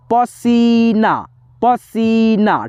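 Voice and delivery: पसीना with सी drawn out; पसीना said slowly as pa-seee-na; stretched long ee. Shown as a demonstration. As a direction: hold the middle vowel of पसीना slowly. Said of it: "थोड़ा सा खींच करके आवाज़ आया".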